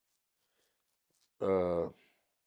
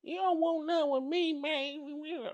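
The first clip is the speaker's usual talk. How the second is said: much higher, fake pimp swagger; fake pimp act; 6 dB quieter than usual. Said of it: "All this little fake pimp bullshit act".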